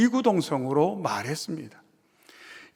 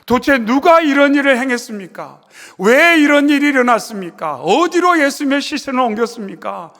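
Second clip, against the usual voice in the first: distressed outcry; 5 semitones higher, 4 dB louder than usual. Acted. Preacher voices Mary's distress.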